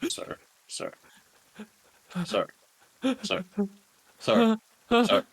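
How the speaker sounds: repeated sirs, building to high and loud; insistent, rising attempts to get his attention; urgent calling. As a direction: break through to someone in shock. Trying to snap the man out.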